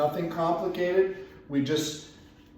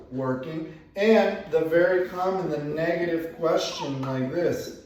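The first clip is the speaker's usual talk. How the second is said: ordinary delivery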